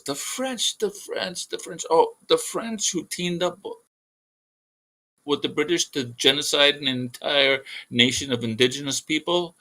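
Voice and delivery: high-voiced alien working out who is meant; recollecting alien. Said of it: "And the alien goes".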